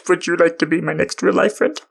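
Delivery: sugary fake invitation to fans; mock sweet invite. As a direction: offer it like a cheesy pickup line.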